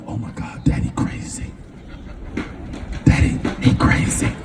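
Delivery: hushed unvoiced whisper; whispering kids. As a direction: whisper it wide-eyed behind the window.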